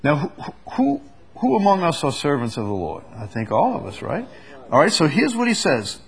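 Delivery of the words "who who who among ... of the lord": usual speaking voice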